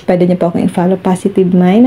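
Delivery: ordinary talk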